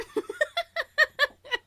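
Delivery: bright high laugh. Real, not acted. Singer laughing at friend, laughing. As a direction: crack up.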